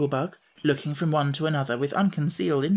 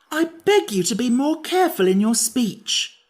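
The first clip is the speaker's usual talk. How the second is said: higher, louder sharp retort; sharp rebuke; 9 semitones higher, 5 dB louder than usual. A narrator's reading. Pumpkinhead scolding the Sawhorse, sharp and loud. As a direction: cut him off sharply. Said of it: "said the Pumpkinhead sharply".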